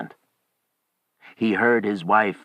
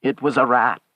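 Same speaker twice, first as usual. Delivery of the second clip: raised, louder, shaken reply; shaking voice; 7 semitones higher, 5 dB louder, faster than usual. A narrator's reading. Old man explains away the knock, shaking voice. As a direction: answer with a nervous shake. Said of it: "said the old man in a shaking voice".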